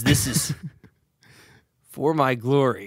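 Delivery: attempted hero voice, measured like text-to-speech; stiff, paced recitation; Captain America. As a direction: recite it evenly, phrase by phrase. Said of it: "text to speech".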